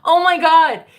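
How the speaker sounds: loud, high "oh my God" at chat; a shocked, amused exclamation; exclaiming. Real, not acted. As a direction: burst out in disbelief. Reacting to troll in chat.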